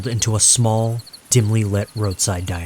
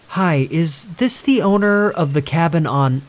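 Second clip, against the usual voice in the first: put-on higher, urgent young woman; higher anxious caller; 8 semitones higher than usual. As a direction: press the owner for answers. April urgently questioning the owner.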